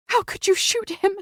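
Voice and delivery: high, tearful woman's voice; through a sob. Acted. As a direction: accuse him through tears. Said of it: "she asked through a sob".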